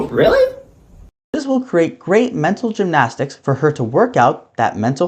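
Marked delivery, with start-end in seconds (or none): 0.1-0.6 squeaky high "Really?" in fake shock; mock disbelief.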